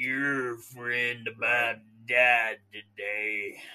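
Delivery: imitating a chain-smoking biker; heavy smoker voice. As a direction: drag it out like four hundred cigarettes today. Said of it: "I've had four hundred cigarettes today".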